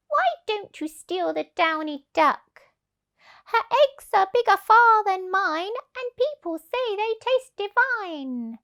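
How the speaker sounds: high, wheedling voice; coaxing hen. Acted. Hen persuading the thieves, high and coaxing. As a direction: talk the thieves into it sweetly. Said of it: "the fat red hen began to cluck".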